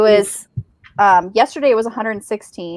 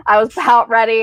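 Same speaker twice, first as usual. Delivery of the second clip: ordinary talk, nothing marked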